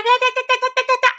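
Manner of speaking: high da-da chatter for endless talk; mock blah-blah chatter; mock yapping. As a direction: babble like someone who won't shut up. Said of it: "all the mouth".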